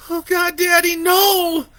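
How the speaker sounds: put-on high loud wailing child; sobbing cry. A narrator's reading. Boy crying over his father, wailing no. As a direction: wail it through tears.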